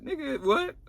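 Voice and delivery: high, disbelieving what; can't believe what she heard; sudden high disbelief; incredulous. Real, not acted. Incredulous reaction to friend.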